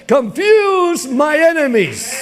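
slow impassioned plea; fervent cry. Shown as a demonstration. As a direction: stretch "Confuse" and lift the end. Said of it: "We gotta learn how to cry that way".

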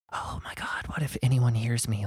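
anxious hushed whisper; worried whisper. Retold or imitated. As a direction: whisper it like someone might overhear. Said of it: "I'd just been like".

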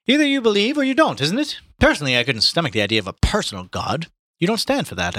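high, quick English gent; Haynes voice. A narrator's reading. Reader voicing Haynes to the group, high and quick. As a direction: lift the voice for the visitor.

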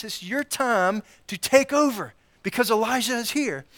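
an excited disciple sure the big day has come; Peter's excited realization. Retold or imitated. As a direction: Peter getting carried away. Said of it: "Peter in his head is putting two and two together going".

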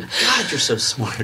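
gushing praise. Retold or imitated. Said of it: "I'm like".

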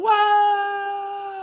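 high, far-off yelp while falling; Gopher falling. Pretend. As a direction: yelp like a gopher tumbling down a hole. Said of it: "pretend I was Gopher falling down the hole".

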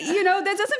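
ordinary delivery

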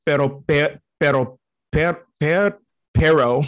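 "pero" restarted and stretched, searching for the tap; haltingly retry "pero"; trying pero. Shown as a demonstration. Asked to say but, struggling with pero. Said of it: "can you say but".

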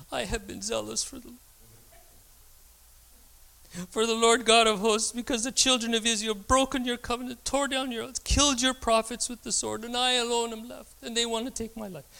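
high, plaintive complaining voice; dramatized whining Elijah. Retold or imitated. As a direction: whine it like a sulking prophet. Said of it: "I'm gonna dramatize it a little bit".

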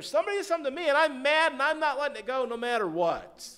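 fast, high, loud angry outburst; prideful anger. Acted. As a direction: rant at full volume, refusing to let it go. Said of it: "Prideful anger".

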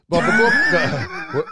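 sudden shout to break in; loud interruption. Real, not acted. Host shouting to get the guests back on topic. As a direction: cut through the banter, loud.

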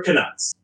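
"Canucks" said kuh-NUCKS; corrected pronunciation. Shown as a demonstration. Montrealer showing the guest, "Canucks" rhyming with ducks. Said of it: "pronounce it wrong".

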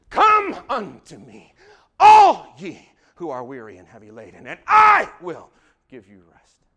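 loud crying call, slow at first; preached cry. Acted. Preacher calling the weary to come, crying out loud. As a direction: cry it out like an open invitation.

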